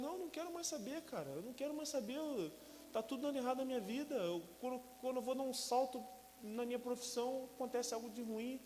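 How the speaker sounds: high, strained refusal; anguished refusal. Retold or imitated. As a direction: refuse desperately, near tears. Own quote, refusing God.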